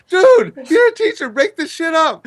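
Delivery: high-pitched, laughing retold protest; laughing mock plea. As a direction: laugh while pleading with the teacher. Retold plea to watching gym teacher.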